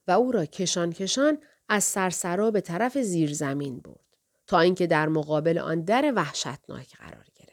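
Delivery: plain reading voice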